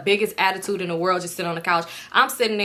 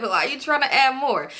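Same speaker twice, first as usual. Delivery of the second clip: ordinary talk, nothing marked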